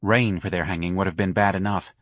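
ordinary delivery, nothing marked

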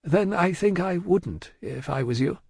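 discourage him as delicately as possible; cautious discreet banker; tactful caution. A narrator's reading. Lorry tactfully advises against it.

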